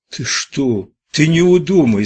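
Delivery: high, loud anxious warning; rising worry, voice climbing; alarmed. A narrator's reading. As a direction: plead in sudden alarm. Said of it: "забеспокоился Кутя".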